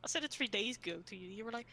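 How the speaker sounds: usual speaking voice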